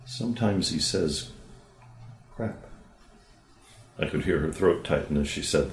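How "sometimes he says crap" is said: child slowly, softly owning up to a bad word; hushed, hesitant, dropping to near silence on crap; soft and cautious. Girl tells her therapist quietly about her brother's words, soft and cautious. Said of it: "said softly, cautiously".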